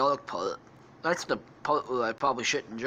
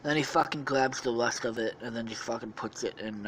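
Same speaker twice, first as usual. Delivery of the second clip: ordinary delivery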